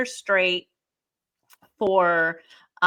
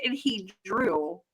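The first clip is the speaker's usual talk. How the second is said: ordinary talk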